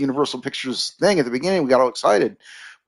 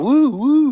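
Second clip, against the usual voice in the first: high, louder "woo, woo"; imitated zoom whoosh; 5 dB louder than usual. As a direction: mimic a swooping camera effect in a high hoot. Imitating in-and-out camera zoom effects.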